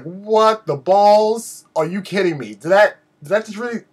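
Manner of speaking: acted-out disbelief, drawing out the first words; incredulous reaction. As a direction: relive being stunned, pitch climbing as it goes on. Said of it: "I was like".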